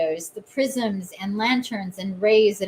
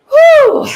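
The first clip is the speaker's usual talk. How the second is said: loud, high emotional exhale; whew; 16 dB louder than usual. Real, not acted. Welling up over the card, she lets out a loud high whew. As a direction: blow out the feeling with a big loud whew. Overwhelmed by emotion mid-reading.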